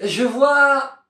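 very high, excited call; sister on lookout. Acted. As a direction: announce a sighting from afar. Sister spots riders.

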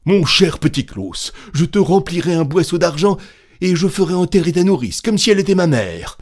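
high, loud, desperate begging; pleading lament. A narrator's reading. Innkeeper bargaining with Petit Claus for silence, desperate pleading. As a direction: wail the confession, then beg urgently.

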